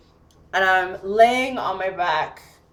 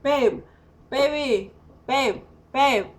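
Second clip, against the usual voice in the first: calling out, higher pitched; retold yelling; 5 semitones higher, slower than usual. Locked-out woman calls to sleeping partner, retold yelling. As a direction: call for a partner through the window, mildly raised. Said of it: "I'm yelling and I'm banging".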